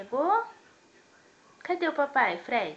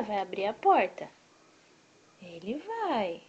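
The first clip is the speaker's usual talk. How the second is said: ordinary delivery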